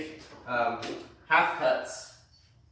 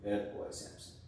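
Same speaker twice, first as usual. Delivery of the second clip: soft whisper to dog; 3 semitones lower, 10 dB quieter than usual. Real, not acted. Praising dog during nail trim.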